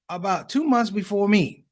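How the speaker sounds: ordinary delivery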